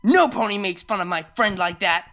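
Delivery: loud, defiant defence; angry shout. Acted. Rainbow Dash defending her friend from the snob, shouting. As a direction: raise your voice in protest. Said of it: "shouted Rainbow Dash".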